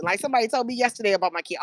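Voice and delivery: ordinary delivery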